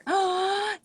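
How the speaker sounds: high, drawn-out gasp of delight; real high intake of breath; touched gasp. Real, not acted. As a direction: gasp at an unexpected compliment. Reacts to a compliment.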